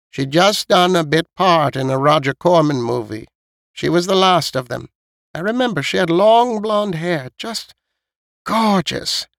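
put-on high-pitched old lady voice; old woman telling an old story in a high voice; reminiscing old woman. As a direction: recall it fondly as an elderly neighbour, pitched well up. Voices old Missus Knight.

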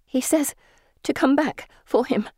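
high, breathless-style bursts of words. A narrator's reading. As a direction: get it out in short, high bursts. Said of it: "I say in bursts".

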